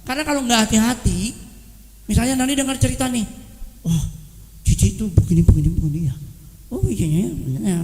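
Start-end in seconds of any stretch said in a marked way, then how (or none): 3.9-7.8 gossip voice. Retold or imitated. Gossiping neighbour voiced in sermon.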